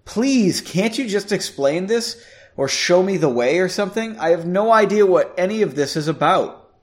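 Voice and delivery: high, urgent pleading; exasperated, desperate acted plea; nearly begging. A narrator's reading. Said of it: "nearly begging".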